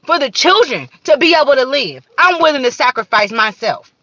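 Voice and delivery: loud, high, fervent declaration; impassioned outcry. Real, not acted. Ranting to viewers about sacrificing for the children, loud and high. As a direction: pour it all out like a sermon at full force.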